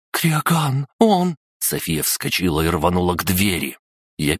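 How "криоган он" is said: high, panicked cry; испуганный крик. Acted. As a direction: cry out in terror. (испуганный вскрик)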